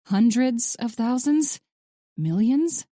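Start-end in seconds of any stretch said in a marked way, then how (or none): none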